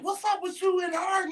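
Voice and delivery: high, squeaky friend voice; mock nosy friend. Friends asking about the couple, high and nosy.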